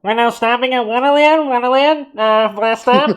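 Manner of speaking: high, loud tinny train announcement; subway announcer. Pretend. Mock Blue Line announcement for the cohosts, loud and tinny. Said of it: "blue line doors".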